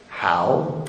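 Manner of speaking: low, quiet, puzzled mumble; mock bewildered how. People playing innocent to God, mumbled low. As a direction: play dumb in a low murmur. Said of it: "And they're like".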